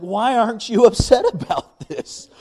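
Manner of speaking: high, loud mock outburst; hammed-up retold exasperation; upset, incredulous. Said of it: "and she wasn't mad".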